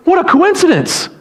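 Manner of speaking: high-pitched imitation of amazement; imitated delighted surprise; pleasantly surprised woman. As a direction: exclaim at an unexpected meeting. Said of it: "she was like".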